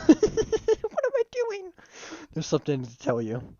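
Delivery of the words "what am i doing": high, breaking into giggles; can't get through it for laughing. Real, not acted. Trying to confess feelings, cracking up.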